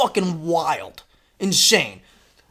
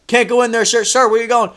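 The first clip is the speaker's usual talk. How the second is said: raised voice, higher, calling him back; security calling out; 7 semitones higher, 5 dB louder than usual. Retold or imitated. Security guard calling after the intruding dad, raised voice.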